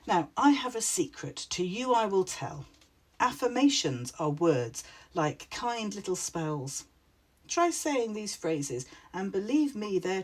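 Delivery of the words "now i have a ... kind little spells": plain reading voice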